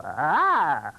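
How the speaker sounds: drawn-out demonstration "aah" from the throat; sustained back-of-throat vocal sound; throaty "ah". Shown as a demonstration. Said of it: "You can go".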